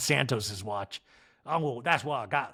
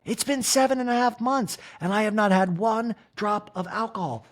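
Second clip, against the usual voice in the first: higher mock Kyle voice; Kyle impression; 8 semitones higher than usual. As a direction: proudly announce it, pitched up. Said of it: "Kyle in a talking head goes".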